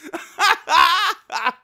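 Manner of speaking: high burst of laughter; cracking up at the joke; real laugh. Real, not acted. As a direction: laugh it off, amused by the absurdity. Laughing at absurd opponent choice.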